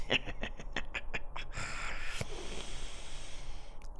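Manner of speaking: long breathy sinister chuckle; creepy whispered laugh. Acted. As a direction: chuckle softly like a predator.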